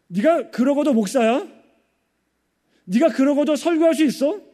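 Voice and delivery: high, mocking interrogation; accusing Satan. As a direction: needle him with scornful, rising questions. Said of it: "사탄은요, 우리에게 늘 정죄감을 줘요".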